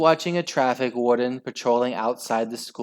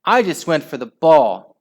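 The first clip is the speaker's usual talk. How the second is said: loud, higher self-defence; shrieked protest; 4 semitones higher, 8 dB louder than usual. A narrator's reading. Henry excusing his foul, shrieking protest. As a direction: loudly protest innocence. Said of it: "shrieked Henry".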